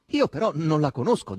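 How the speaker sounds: loud, curt rebuff. A narrator's reading. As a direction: say it dryly, with emphasis. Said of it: "disse seccamente Margherita".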